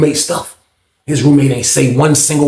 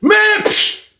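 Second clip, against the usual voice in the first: high, dismissive scoff; retold scoff. Roommate caught out, scoffing high. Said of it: "that's all you heard was".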